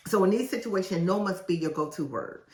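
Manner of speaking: ordinary talk, nothing marked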